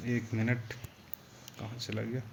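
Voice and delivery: quiet, absent-minded self-talk; muttering to self. Real, not acted. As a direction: say it under your breath while hunting for something. Searching for something, talking to self.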